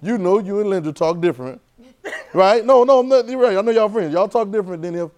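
loud, high, rousing preaching; energetic sermon push; preaching at full force. Acted. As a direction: call out to the congregation, fired up. Preacher presses congregation on speech.

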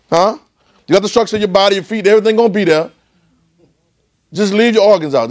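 fervent preaching. Acted. Preaching at full force.

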